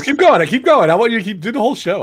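high, loud playful egging on; excited urging. Real, not acted. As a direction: push him on, teasing and loud. Host teasingly urges co-host on.

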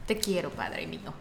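mucho más grave y suave, con cariño exagerado; tierno y suave. Retold or imitated. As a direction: dilo casi al oído, con cariño. Abrazo al papá. (abrazando al papá, tierno y bajito)

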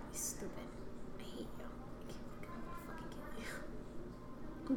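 muttered threats in a whisper; seething under her breath; whispered inner rant. Retold or imitated. Silently cursing her coworkers in her head, whispered. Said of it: "And I'm like".

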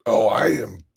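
ordinary delivery

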